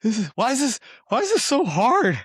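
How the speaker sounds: high, rising exasperated whine; mock frustrated whine. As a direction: sound flustered, climbing in pitch as you struggle. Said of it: "I'm like".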